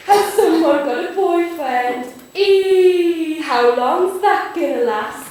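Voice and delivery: slow, loud, sing-song teasing; teasing Nana. Acted. Nana teases granddaughter about a boyfriend, slow and gleeful. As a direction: draw out the gleeful "eee".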